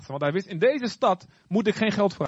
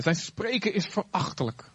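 ordinary delivery

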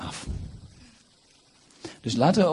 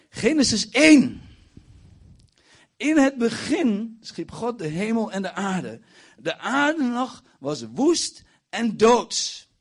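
slow, high, booming proclamation; scripture declamation; 12 semitones higher, 5 dB louder, slower than usual. Acted. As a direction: declaim each phrase loudly, stretched out. Declaims scripture at full force. (plechtig, luid en langgerekt gedeclameerd)

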